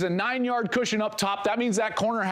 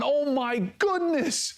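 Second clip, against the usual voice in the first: amazed; 8 semitones higher, slower than usual. Real, not acted. Amazed reaction to a throw.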